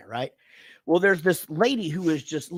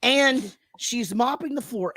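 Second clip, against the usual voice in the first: ordinary delivery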